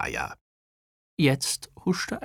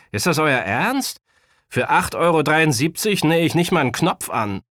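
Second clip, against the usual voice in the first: put-on higher, louder, lightly indignant boy; indignant young tailor; 6 semitones higher, 5 dB louder than usual. A narrator's reading. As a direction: scoff at the offer as a young tailor. Voices Omid refusing the low fee. (leicht empörter junger Schneider)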